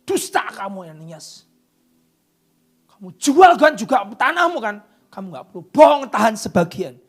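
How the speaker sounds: loud accusing shout; God through Peter, shouted rebuke of a liar; angry rebuke. Acted. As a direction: thunder the accusation. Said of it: "Begitu Tuhan ngomong sama Petrus".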